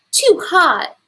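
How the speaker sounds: too hot cry. A narrator's reading. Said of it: "she cried out".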